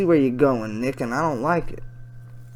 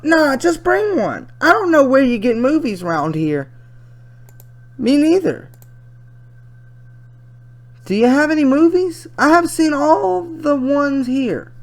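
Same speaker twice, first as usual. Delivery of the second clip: higher-pitched put-on girl voice; chatty teen girl; 13 semitones higher, 7 dB louder than usual. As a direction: read her messages as a young girl, pitched up. Reads chat as teenage girl.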